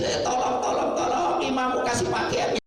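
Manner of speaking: mock calling out. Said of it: "ibu-ibu teriak-teriak".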